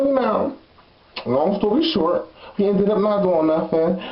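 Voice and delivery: ordinary delivery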